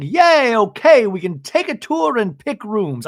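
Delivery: high, loud cheer easing off; hammed-up imitation of an excited hostess; mock excitement. Imitating Braunwyn's cheer on arrival.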